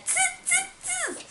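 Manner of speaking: high-pitched teasing "tss" noises; three squeaky, hissed monkey sounds; monkey chatter. Acted. Monkeys mock the scolding peddler, high monkey chatter.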